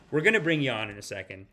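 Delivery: normal talking voice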